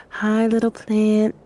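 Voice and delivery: put-on tender baby talk to a little plant; soft coaxing to a plant. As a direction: coax the plant so it won't prick her. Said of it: "talking real soft to the plant".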